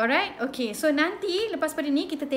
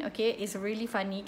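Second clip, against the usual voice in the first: usual speaking voice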